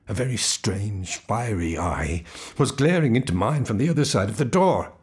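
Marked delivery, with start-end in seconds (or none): 0.1-2.2 slow. A narrator's reading. Dwelling on the eye.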